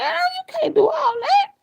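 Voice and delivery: very high, crying wail; mock wail. Mother crying out at her daughter, very high wail. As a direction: wail it high like a hurt plea.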